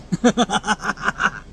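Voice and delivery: short high laugh at his own jab; a quick amused chuckle; mocking laugh. Real, not acted. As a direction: laugh it off, teasing. Laughs after Google jab.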